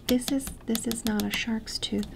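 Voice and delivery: ordinary talk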